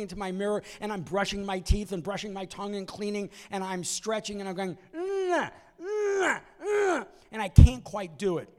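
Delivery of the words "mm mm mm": straining hum. Retold or imitated. Straining to reach his nose.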